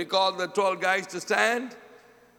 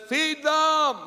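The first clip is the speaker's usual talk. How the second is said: high, raised-voice order; firm command; 8 semitones higher, 4 dB louder than usual. Acted. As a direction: call it out as an emphatic command. Jesus's command to the disciples.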